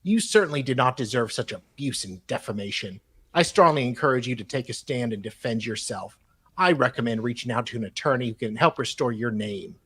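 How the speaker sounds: reading a letter out in a measured, formal tone; email read aloud. Acted.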